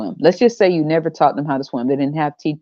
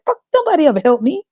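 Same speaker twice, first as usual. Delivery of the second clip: mock bitter parent; 10 semitones higher, faster than usual.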